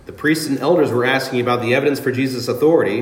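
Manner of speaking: ordinary talk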